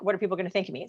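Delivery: low, soft voice in the head; inner thought. Retold or imitated. Worrying to herself about others' opinions, quiet inner thought. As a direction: murmur it like a nagging thought. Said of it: "going through my mind".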